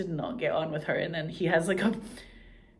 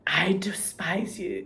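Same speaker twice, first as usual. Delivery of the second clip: slow, breathy whisper; whispered line; 2 semitones higher, slower than usual. Retold or imitated. Quoting the brother to the heroine, a slow whisper. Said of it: "I'm just like".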